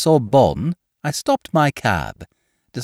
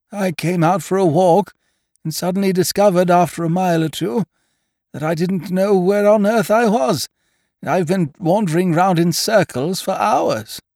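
higher-pitched plaintive dimwit; hapless, muddled chap; 6 semitones higher than usual. A narrator's reading. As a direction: recount your woes as a helpless silly friend. Biffy voiced by narrator.

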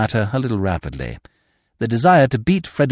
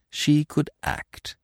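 ordinary delivery, nothing marked

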